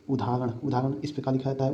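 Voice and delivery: ordinary talk, nothing marked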